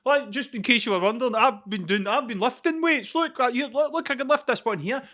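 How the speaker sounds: puffed-up posing, showing off weights; show-off gym guy. Retold or imitated. Mocking a gym poser flexing at the weights, lightly voiced.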